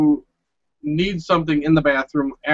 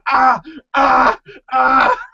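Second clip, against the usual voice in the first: imitated pained yelps; 6 semitones higher, much slower than usual. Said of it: "She keeps burning herself".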